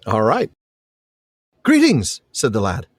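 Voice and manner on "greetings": put-on high, bright-eyed boy's greeting; high-pitched boy character; young lad. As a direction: voice an eager boy, pitched well up. Reads the lad's dialogue.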